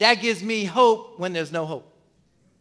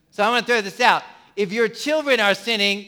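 ordinary delivery

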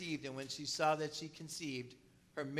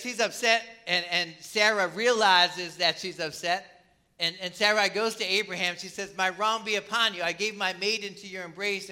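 preaching; 4 semitones higher, 7 dB louder than usual. Real, not acted. Pastor preaching the Sarah story.

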